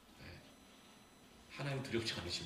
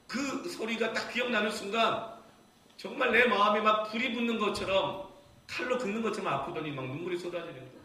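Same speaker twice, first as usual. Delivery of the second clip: loud, high surge of heartache; impassioned preaching; 10 semitones higher, 8 dB louder than usual. Real, not acted. Preacher recalls his son's words piercing him, impassioned. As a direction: let the remembered pain burst out loudly.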